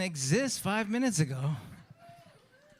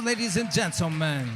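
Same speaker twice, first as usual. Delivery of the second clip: ordinary talk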